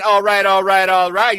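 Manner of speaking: lightly voiced catchphrase; a hint of a lazy "all right, all right"; mock "all right" drawl. Shown as a demonstration. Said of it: "Instead of saying".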